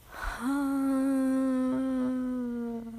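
high, drawn-out voiced sigh; long sigh. Real, not acted. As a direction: let out a long sigh that slowly sinks. Tired, asked for last words.

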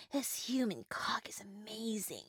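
soft breathy whisper. Acted. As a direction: whisper it close. Intimate scene, whispered.

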